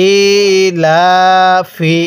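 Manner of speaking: ইলাফি read very slowly, each long vowel held one alif; slow tajweed-style elongation of ইলাফি; drawn-out recitation. Shown as a demonstration. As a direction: stretch ইলাফি into a slow Quranic chant. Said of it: "হ্যাঁ, পড়ুন।".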